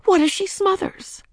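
high, frantic worried question; panicked thought, pitched right up. A narrator's reading. Ramona fearing for her stuck little sister, frantic. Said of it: "thought equally frantic Ramona".

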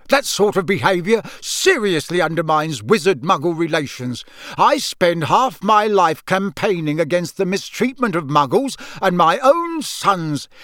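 a wounded father's rant; angry lecture. Acted. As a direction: scold them hard, slowing at the end. Father lectures his sons angrily.

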